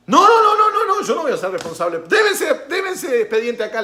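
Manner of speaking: high, loud frantic refusal with laughs; hammed-up frantic denial; frantic official. Official refusing blame, retold. (funcionario desesperado que rechaza la culpa)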